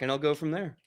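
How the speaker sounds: normal talking voice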